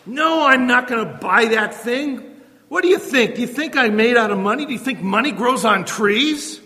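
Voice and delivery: hammed-up parent nagging the child about money; exasperated parent. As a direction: play the fed-up parent refusing to buy. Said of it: "You're not gonna be harsh".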